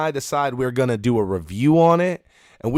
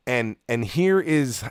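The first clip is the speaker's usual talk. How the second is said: ordinary talk, nothing marked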